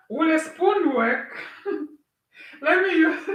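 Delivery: giggling through the words; laughing. Real, not acted. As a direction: laugh while asking. Laughs while asking about spoon.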